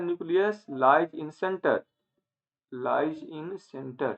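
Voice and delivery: drawn-out teacherly phrasing, very slow; slow dictation. Real, not acted. Teacher telling students where the nucleus lies, slow dictation. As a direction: measure out each word for note-taking.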